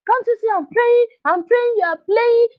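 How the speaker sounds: high, sharp mock scolding of a child; irritated parent. Parent scolding a playing child while praying, high and annoyed. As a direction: snap at the kid like a busy parent.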